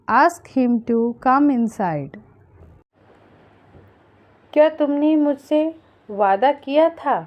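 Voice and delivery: ordinary talk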